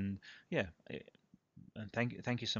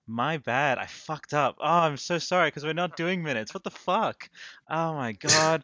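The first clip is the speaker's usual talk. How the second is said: loud, high, embarrassed apology; blurting out the mistake in a panic; flustered outburst; 7 semitones higher, 11 dB louder than usual. Real, not acted. Host messing up the intro, loud embarrassed outburst.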